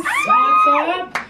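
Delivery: very high squeaky What's up; squeaky greeting. Acted. Repeats greeting much higher.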